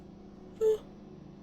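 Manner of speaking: long unvoiced yawn; big yawn. Real, not acted. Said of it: "I don't know why I'm yawning a lot".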